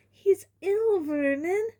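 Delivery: high, alarmed mother; anxious report to husband; mother telling husband son is ill; Aunt Petunia. A narrator's reading.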